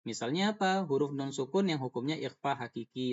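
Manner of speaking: ordinary talk, nothing marked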